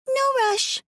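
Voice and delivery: put-on high child voice, a bit weary; little girl Alice. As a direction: speak as a young girl telling her story.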